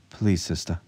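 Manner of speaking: usual speaking voice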